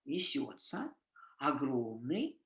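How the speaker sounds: slow, low and soft, "огромный, страшный" drawn out; напряжённое повествование; dramatic storytelling. Shown as a demonstration. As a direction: tell it like a scary tale. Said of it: "in Russian I can say".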